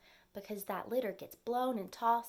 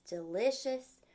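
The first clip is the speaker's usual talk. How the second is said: plain reading voice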